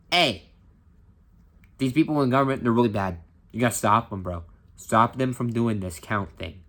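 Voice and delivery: put-on a half-hearted New York accent, pushy; weak New York accent. Teacher imitating Giuliani on stage with a bad New York accent. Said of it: "I don't know how to do a New York accent very well".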